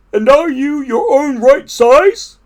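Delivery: high, loud question; Toad's voice. A narrator's reading. Toad checks Frog is normal again, high voice.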